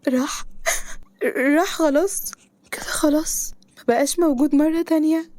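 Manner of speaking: high, crying, half-whispered; collapsing on the floor, asking if he's gone; broken sobbing disbelief. Acted. Woman on the floor sobbing that her love is gone. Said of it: "وقعت على الأرض وهي بتضم رجليها".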